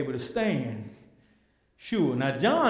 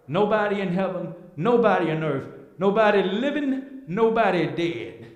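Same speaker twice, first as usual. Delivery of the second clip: rhythmic preaching that builds louder and higher; full-force preaching; 4 semitones higher, 5 dB louder than usual. Acted. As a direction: hammer each repeat from the pulpit.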